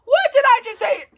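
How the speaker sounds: high, loud, excited outburst. A narrator's reading. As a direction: blurt it out at a very high pitch, thrilled. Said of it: "Then said excitedly".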